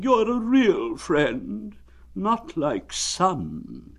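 very slow, high, drawn-out mournful donkey; gloomy Eeyore. Acted. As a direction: drag it out like a sad old donkey. Eeyore thanks.

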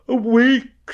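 high, loud moan; gloomy. A narrator's reading. Pooh facing a week stuck, gloomy. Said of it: "said Winnie the Pooh gloomily".

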